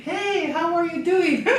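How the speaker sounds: high, slow, laughing hail across the park; mock yelled greeting. Mom calling across the playground, a high slow laughing hail. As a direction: call out cheerily from the far swing. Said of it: "yelling at her from the other side".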